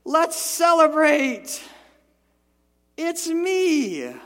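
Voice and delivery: high, loud, slow cheerful announcement; joyful Jesus. Retold or imitated. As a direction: greet the scared men with joy. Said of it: "he says".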